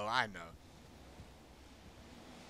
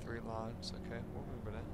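hushed, nervous whisper; 3 semitones lower, 11 dB quieter than usual. Real, not acted. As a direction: breathe it out nervously. Creeping through a dark ship.

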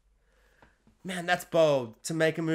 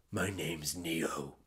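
much lower, quiet, very slow hero voice; deep heroic reply; 13 semitones lower, 12 dB quieter, much slower than usual. Retold or imitated. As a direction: growl it out like a brooding action star. Neo's classic reply quoted.

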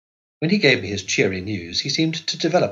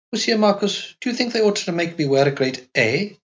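put-on high, light young wife's voice; Carlotta's girlish voice; 10 semitones higher than usual. A narrator's reading. Narrator voicing the young wife to her husband, high and innocent. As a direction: lift into a childlike wife asking innocently.

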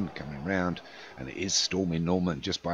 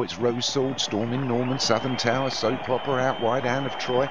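higher and building toward the finish line; race climax call; 6 semitones higher than usual. Acted. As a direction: build the call as they hit the furlong pole.

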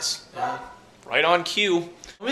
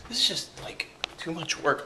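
whispering; 5 dB quieter than usual. Real, not acted. Grumbling about chores.

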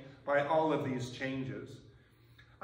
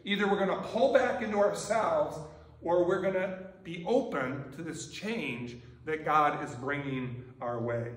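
ordinary delivery

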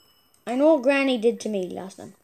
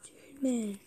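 whisper; 13 dB quieter than usual. Real, not acted. Startled in dark horror game.

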